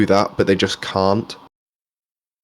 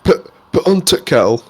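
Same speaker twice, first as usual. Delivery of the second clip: mock northern accent; 6 semitones higher than usual. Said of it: "You know adverts when they put on".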